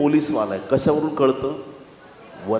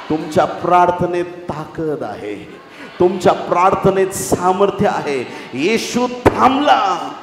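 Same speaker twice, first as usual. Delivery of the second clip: emphatic preaching; 3 semitones higher, 5 dB louder, slower than usual. Real, not acted. Preacher telling believers their prayer has power, rousing proclamation. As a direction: build to a slow, ringing last phrase.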